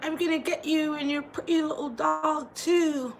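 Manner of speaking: a hint of a cackling movie witch; imitating the witch. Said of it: "the witch is like".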